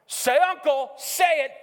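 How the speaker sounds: high, loud bully's demand; acted wrestling taunt, high and loud; demanding shout. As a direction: shout it like a brother forcing surrender. Brother demanding surrender while wrestling.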